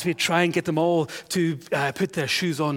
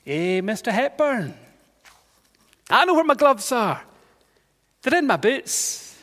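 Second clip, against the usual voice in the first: put-on small boy; 4 semitones higher than usual. Child voiced in story.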